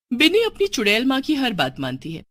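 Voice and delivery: plain reading voice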